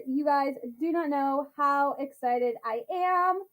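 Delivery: normal talking voice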